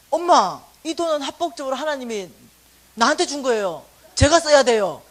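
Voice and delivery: put-on forceful child's demand; loud insistence. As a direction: plant your feet and insist. Son insists on his money.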